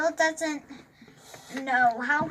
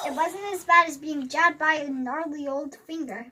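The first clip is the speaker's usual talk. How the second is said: usual speaking voice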